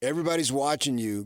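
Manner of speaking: usual speaking voice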